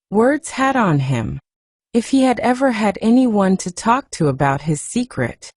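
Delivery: usual speaking voice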